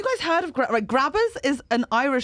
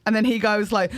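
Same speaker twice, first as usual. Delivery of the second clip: ordinary talk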